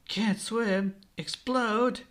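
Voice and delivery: high-pitched, alarmed questions; a touch of panic, pitch jumping up; mild panicked yell. A narrator's reading. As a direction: voice the kid's rising alarm. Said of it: "Luffy yells in panic".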